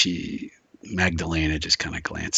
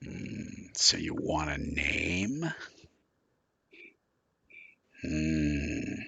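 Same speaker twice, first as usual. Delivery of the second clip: low, soft, drawn-out musing; musing informant; 4 semitones lower, 9 dB quieter, much slower than usual. Acted. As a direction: ponder the offer slowly and quietly, in character. Informant considering a deal, in character.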